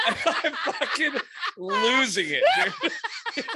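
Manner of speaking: laughing through the words. Real, not acted. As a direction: laugh while you say it. Laughing while telling.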